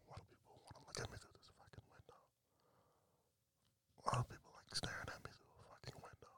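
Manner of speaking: hushed, irritated whisper; annoyed whisper. Real, not acted. Podcaster whispering his annoyance at people staring through the window. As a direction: grumble it under your breath so they can't hear.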